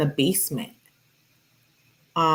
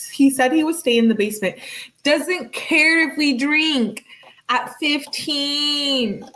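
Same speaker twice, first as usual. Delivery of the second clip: higher, dragged-out mocking reread of the text; mocking singsong; 7 semitones higher than usual. Reading the girlfriend's text back in disbelief, mocking. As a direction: stretch it out like you can't believe it.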